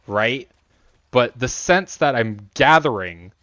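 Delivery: ordinary delivery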